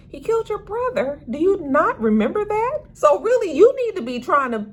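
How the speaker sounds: pitched high, lecturing the screen; incredulous telling-off; exasperated scolding. Real, not acted. Vlogger scolding Trig about his brother's killer, high and exasperated.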